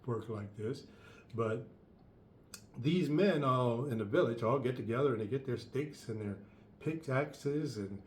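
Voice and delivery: ordinary talk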